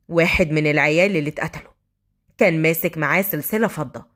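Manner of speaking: low-pitched, mildly firm report; sharp voice. Acted. Undercover officer to his general, restrained sharp report. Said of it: "سراج قال بصوت حاد".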